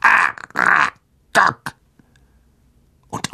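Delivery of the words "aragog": low, very slow spider voice with a rise; spider calling. A narrator's reading. Spider summons Aragog, low slow creature call. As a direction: stretch the name out deep and eerie. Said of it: "rief sie".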